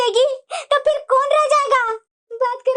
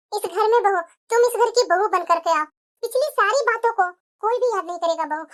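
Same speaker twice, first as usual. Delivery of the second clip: mother-in-law voice; 6 semitones lower than usual. Acted. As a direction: welcome her home with gentle authority. Mother-in-law welcomes daughter-in-law back.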